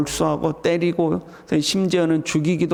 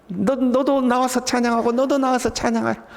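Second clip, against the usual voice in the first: higher-pitched, jubilant urging; joyful calling out; 6 semitones higher than usual. Acted. Saved believer inviting others to praise, joyfully calling. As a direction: urge others to come and join. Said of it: "즐겁게 소리칠 줄 아는".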